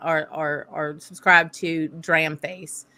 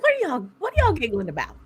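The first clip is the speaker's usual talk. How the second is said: high-pitched, quick mock questioning; retold amused question; 9 semitones higher, faster than usual. Visitor asking the giggling girls, high retold question. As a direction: re-enact asking in a high, fast voice.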